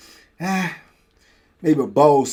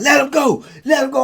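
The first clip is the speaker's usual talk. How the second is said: higher, louder order; guard's command; 11 semitones higher, 5 dB louder than usual. Retold or imitated. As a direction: bark it at him. Guards yelling at him.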